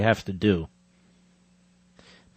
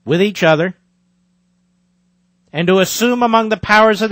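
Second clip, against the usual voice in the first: reading the Declaration aloud, louder and higher than his chat; formal reading; 5 semitones higher, 5 dB louder than usual. Acted. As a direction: proclaim each clause with solemn weight. Reads Declaration aloud, emphatic.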